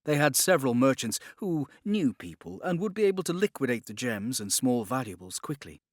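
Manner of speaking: ordinary delivery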